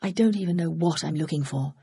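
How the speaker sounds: usual speaking voice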